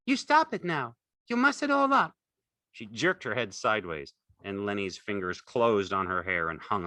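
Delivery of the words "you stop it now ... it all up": high, loud angry command; angry cry. A narrator's reading. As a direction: snap at him to stop. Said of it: "she cried angrily".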